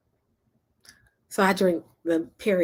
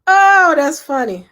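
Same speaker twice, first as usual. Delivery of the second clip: amused; 9 semitones higher, 11 dB louder, slower than usual. Real, not acted. Reacting to a chat comment.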